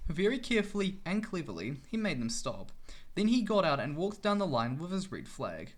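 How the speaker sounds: ordinary delivery, nothing marked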